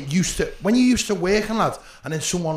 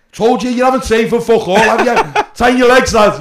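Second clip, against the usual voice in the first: loud, high heckle with a laugh; re-enacted raucous holler; retold shouting; 7 semitones higher, 12 dB louder than usual. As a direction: yell it at your mate up on stage. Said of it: "I'm shouting at him".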